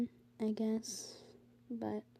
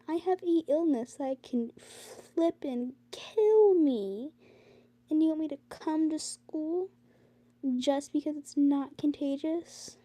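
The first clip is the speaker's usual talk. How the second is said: indignant outburst; 6 semitones higher, 4 dB louder, slower than usual. Real, not acted. Indignant complaint at school.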